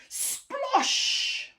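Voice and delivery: high, loud imitated splosh; explosive storybook sound effect; big splash. A narrator's reading. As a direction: burst it out like water crashing. Thieves fall into the pond.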